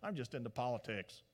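low, soft cynical shrug; cynical Pilate. Retold or imitated. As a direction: dismiss truth with a sneer. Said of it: "Pilate goes".

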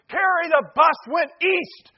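high, loud, drawn-out holler; mom's yelled warning. Retold or imitated. Mom's third call to a sleeping kid, yelling. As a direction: yell a last warning upstairs, stretching the words. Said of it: "about the third call was like".

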